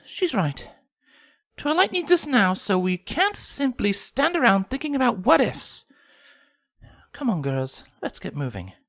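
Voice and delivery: high, refined rallying lady pony; Rarity voice. A narrator's reading. Rarity rallies the girls, high and refined. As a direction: gently take charge. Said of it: "Rarity agreed".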